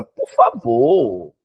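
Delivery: very slow, dragged "por favor"; teacher pleading with Maria Luísa, drawn out slowly; drawn-out plea. Acted. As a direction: beg with mock exasperation.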